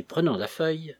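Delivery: plain reading voice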